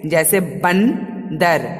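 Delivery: बंदर said very slowly with a dental n-d; slow clear demo. Shown as a demonstration. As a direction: spell out बंदर syllable by syllable. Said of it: "बंगडर नहीं बोलते हैं".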